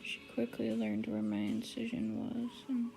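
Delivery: high, sweet pet talk. Real, not acted. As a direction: coo to your pet cat. Cooing to her pet.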